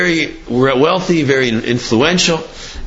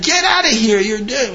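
retold scream; 7 semitones higher than usual. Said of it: "And he started screaming".

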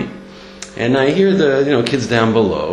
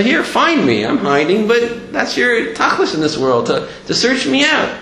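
high, urgent plea in God's role; imploring; 10 semitones higher than usual. Retold or imitated. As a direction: plead to be found, voice pitched up. Speaks as hidden God urging seekers.

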